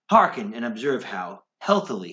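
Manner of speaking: ordinary delivery, nothing marked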